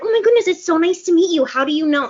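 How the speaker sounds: high, loud, fast mock gush; clueless over-eager welcome; gushing greeter. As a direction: gush at a famous stranger. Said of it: "I'm like".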